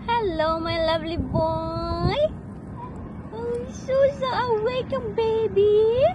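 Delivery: drawn-out, lilting greeting rising high. Real, not acted. Mother greets baby who just woke. (malambing na pagkausap sa sanggol)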